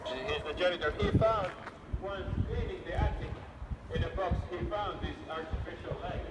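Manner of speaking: hushed storyteller's voice; spooky ghost tour hush. Acted.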